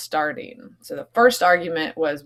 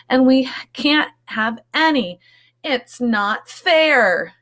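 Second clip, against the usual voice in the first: whiny complaint; 7 semitones higher, 5 dB louder, slower than usual. Acted. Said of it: "said the little mouse's friends".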